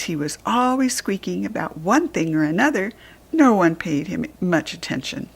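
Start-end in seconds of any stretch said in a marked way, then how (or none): none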